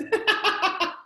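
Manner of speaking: airy laugh bursting out high; breathless high laugh. Real, not acted. Guest cracking up at the cat name Baboing, airy high laugh.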